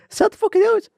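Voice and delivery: very high, fast squeal; high-pitched fake alarm; mock panicked. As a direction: squeak it out in a comic rush. Acting out startled reaction to aliens.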